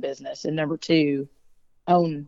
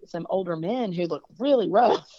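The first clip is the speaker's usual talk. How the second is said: ordinary delivery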